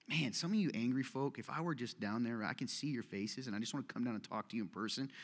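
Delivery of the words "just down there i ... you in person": fast, hushed confiding aside; rushed aside. Real, not acted. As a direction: hurry it under your breath.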